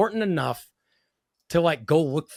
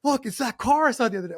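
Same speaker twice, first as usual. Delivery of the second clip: excited look look; 8 semitones higher than usual. Retold or imitated. Chip excitedly shows his assistants.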